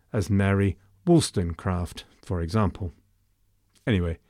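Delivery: ordinary talk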